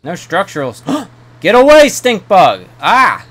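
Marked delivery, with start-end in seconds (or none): 0.9-1.4 startled gasp. Real, not acted. Startled by stink bug. 1.4-3.3 high, very loud startled yell; yelling at bug. Real, not acted. Gamer yells at a stink bug in panic, high and very loud. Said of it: "I'm yelling at the stink bug".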